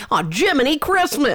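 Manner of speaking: high, prim mock "Jiminy Christmas"; mocking goody-goody exclamation. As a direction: parody his corny dad exclamation. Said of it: "you're like".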